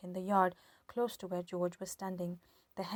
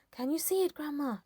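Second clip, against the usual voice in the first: excited shout; 7 semitones higher, 4 dB louder than usual. A narrator's reading. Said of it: "George shouted".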